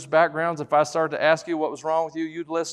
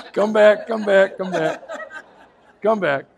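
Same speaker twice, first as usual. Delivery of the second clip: laughing call; 5 semitones higher, 4 dB louder than usual. Real, not acted. Calling to crying baby.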